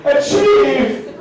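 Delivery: high, tearful blubbering, hammed up; mock bawling. Comic on the couch cheering a TV contestant, mock bawling. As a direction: choke out praise as if bawling. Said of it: "I am bawling".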